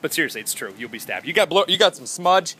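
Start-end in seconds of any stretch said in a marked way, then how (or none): none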